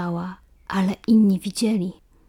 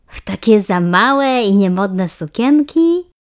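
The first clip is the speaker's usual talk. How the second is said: mocking question; 7 dB louder, slower than usual. A narrator's reading. Iska mocks Asia's dresses.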